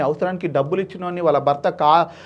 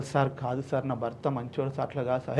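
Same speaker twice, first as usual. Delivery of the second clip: softer, lower, a wife's weepy pleading; tearful plea; 5 semitones lower, 11 dB quieter than usual. Retold or imitated. Wife begging the judge for her husband, quietly tearful. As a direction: plead for your husband through tears. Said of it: "నా భార్య బొలబొల ఏడుస్తుంది".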